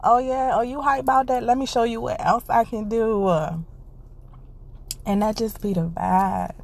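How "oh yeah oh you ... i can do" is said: playful God voice, higher, hyped and teasing; mock God voice, higher, gently bragging; voicing God. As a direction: tease like God showing off what he can do. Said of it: "he be like".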